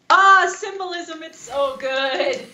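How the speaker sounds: slow, swooning gush over symbolism; high, slow, overcome with delight. Retold or imitated. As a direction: melt with admiration, drawn out. Gushing over film symbolism.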